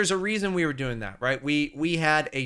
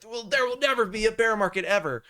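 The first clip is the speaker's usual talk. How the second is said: higher-pitched mock certainty; mock bull-market believer; 7 semitones higher than usual. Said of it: "there's always some reason to be like".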